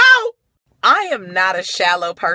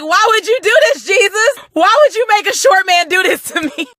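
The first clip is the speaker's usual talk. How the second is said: high, loud, fast mock wail; hammed-up high-pitched lament; anguished plea to Jesus; 12 semitones higher, 7 dB louder, faster than usual. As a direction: cry out to heaven in dramatic despair. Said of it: "And I was like".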